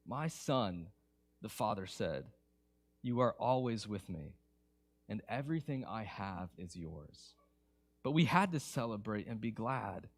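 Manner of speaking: soft, low and tender, almost fading away; calm the angry son, quietly and lovingly; gentle fatherly reply. Acted.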